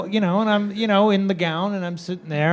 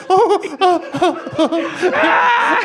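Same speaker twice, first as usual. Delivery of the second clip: high laughing ohs; reacting to cold hands, higher; mock startled oh; 9 semitones higher, much slower than usual. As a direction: squeal through a laugh. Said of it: "and I'm like".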